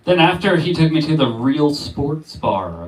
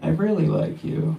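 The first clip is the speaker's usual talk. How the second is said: playing shy; 4 semitones higher than usual. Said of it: "I get to play real shy".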